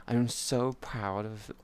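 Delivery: soft, slow cooing praise; praising chat like good pets; soothing praise. Pretend. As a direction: coo gently like to a pet.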